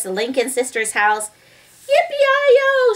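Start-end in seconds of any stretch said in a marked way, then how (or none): 1.9-2.9 high, loud yippee holler; shouted cowboy whoop. A narrator's reading. Leroy whooping as he gallops off, shouted. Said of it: "shouted Leroy and Anchor".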